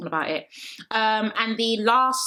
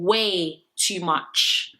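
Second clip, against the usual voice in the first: "way too much" very slow, each word stretched; emphatic drawl; much slower than usual. Real, not acted. Complaining he was talking too much, "way too much" slowly stretched.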